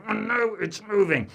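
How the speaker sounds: strained grunt through effort; loud, straining, exasperated reply; grunting back. Acted. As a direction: grunt it while hanging on hard. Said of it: "I'm grunting back at him".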